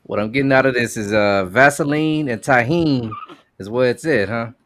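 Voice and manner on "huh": ordinary talk, nothing marked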